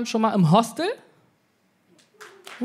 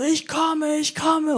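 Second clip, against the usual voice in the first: mock orgasm cry; 9 semitones higher, much slower than usual. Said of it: "schreit so".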